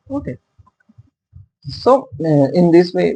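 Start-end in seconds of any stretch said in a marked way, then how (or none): none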